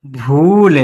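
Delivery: long drawn-out "भूल". Shown as a demonstration. Teacher showing the long ū matra, "भूल" held long. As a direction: hold the ū in "भूल". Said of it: "थोड़ा सा देर तक बोल लें".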